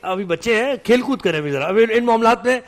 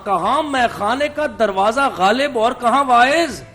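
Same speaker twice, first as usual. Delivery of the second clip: poetry recital; 5 semitones higher, 3 dB louder, much slower than usual. Acted. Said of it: "غالب نے کیا خوب کہا ہے".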